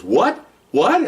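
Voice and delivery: sharp high rising double take; incredulous captain. Retold or imitated. Captain reacting to a demand for a person, incredulous. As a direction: imitate a boss caught off guard.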